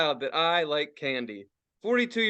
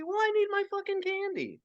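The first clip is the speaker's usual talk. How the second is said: mock whiny craving; 13 semitones higher, 5 dB quieter than usual. Comic rant about candy craving.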